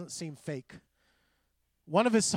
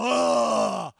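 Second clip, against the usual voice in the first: loud, higher grumbling ugh; loud exasperated ugh, mocking; loud mock groan; 4 semitones higher, 10 dB louder than usual. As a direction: groan like a complaining adult. Mimicking the grumbling complaint.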